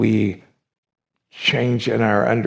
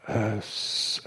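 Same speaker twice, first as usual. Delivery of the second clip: usual speaking voice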